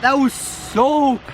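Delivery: shocked by cold. Real, not acted. Just out of cold water.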